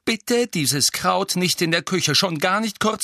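high, loud exasperated scold. Acted. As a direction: tell him off sharply. Mrs Weasley scolding.

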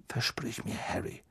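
very soft, urgent plea; hurried. A narrator's reading. Father pleading for a promise as doors slam, hushed urgency. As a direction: press him under the breath. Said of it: "sagte Mister Weasley noch hastiger".

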